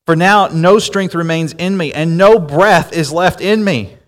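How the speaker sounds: solemn, measured Bible reading; scripture reading. Acted. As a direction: read it like holy text to the congregation.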